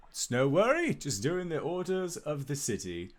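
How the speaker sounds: high, lilting guardsman reply; cheerfully dutiful guard, pitched well up; town guardsman. Acted. Guard answering Albus's thanks, high dutiful guardsman voice. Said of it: "and says".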